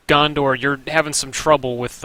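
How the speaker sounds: ordinary delivery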